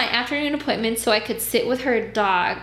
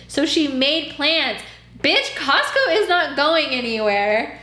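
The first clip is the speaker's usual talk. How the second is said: escalating comedy rant; 4 semitones higher than usual. Real, not acted. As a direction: rant at the client. Outraged retelling.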